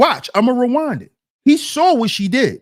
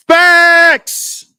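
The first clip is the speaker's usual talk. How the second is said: loud, high, drawn-out shout; emphatic agreement; 9 semitones higher, 6 dB louder than usual. Real, not acted. Endorsing a chat comment, emphatic shout. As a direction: belt it out as one long emphatic word.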